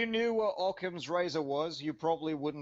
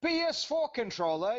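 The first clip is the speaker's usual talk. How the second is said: pitched up high, a disbelieving question; incredulous echo; 7 semitones higher than usual. Real, not acted. Gamer scoffs back at the insult, incredulous. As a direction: scoff at the idea, voice jumping up. Mocked about his PS4 controller.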